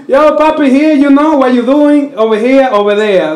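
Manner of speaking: high, mocking fake Dominican accent; faked Dominican accent. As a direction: imitate kids badly faking a Dominican accent. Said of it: "people are faking to have an accent".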